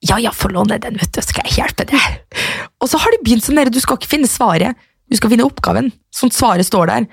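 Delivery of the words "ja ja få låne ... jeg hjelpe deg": soft whisper, slowing at the end; whispered offer. Retold or imitated. Older sister offering help with maths, whispering. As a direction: whisper it, casually confident. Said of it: "Og jeg bare".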